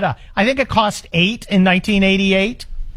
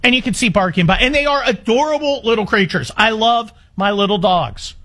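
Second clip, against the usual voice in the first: ordinary talk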